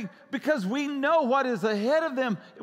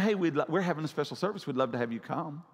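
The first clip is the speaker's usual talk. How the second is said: casual invite; 7 semitones lower, 4 dB quieter, faster than usual. Retold or imitated. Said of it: "Don't just invite them".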